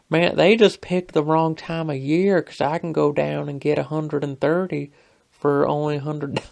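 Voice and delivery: hammed-up country coworker voice; Southern twang. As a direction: imitate a twangy coworker holding forth. Twangy coworker's punchline in retold argument.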